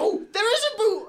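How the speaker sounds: thrilled to find it; a little yelp of joy at finding the boo; excited discovery. Real, not acted. Finds the boo sound button.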